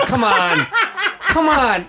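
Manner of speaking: loud, high, laughing protest; laughing disbelief. Retold or imitated. Passenger hearing the flight attendant, laughing disbelief. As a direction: plead it through laughter, incredulous.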